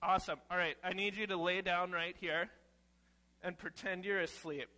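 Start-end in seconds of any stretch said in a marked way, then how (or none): none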